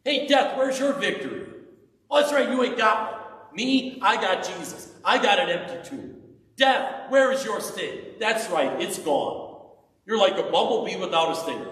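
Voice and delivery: high, loud, mocking taunt. Preacher taunts death for his congregation, high loud mockery. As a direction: jeer at death like a schoolyard bully. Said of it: "asked in this taunting".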